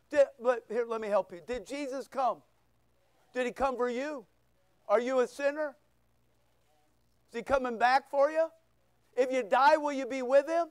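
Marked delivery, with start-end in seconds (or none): none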